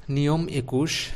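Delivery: ordinary talk, nothing marked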